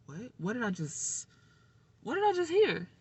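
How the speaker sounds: reliving the moment the news hit; high, cracking disbelief at terrible news; shocked disbelief. Retold or imitated. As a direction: voice jumps high in stunned disbelief. Retelling hearing of mother's death.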